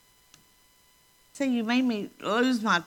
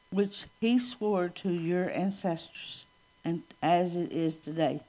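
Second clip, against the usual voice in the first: scripture reading; 3 semitones lower than usual. Acted. Reads scripture aloud.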